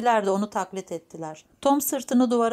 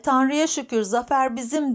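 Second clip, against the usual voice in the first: raised, higher cry of praise, lightly acted; mild shout; 6 semitones higher, 4 dB louder than usual. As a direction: call out victory with some force. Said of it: "diye bağırıyordu". (hafif bağırarak)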